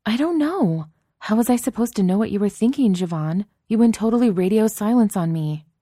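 high, loud exasperated protest; exasperated outburst. Acted. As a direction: throw the blame back, exasperated. Exasperated friend throws up hands.

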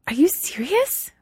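very high, hopeful; can't believe it; hopeful surprise. Acted.

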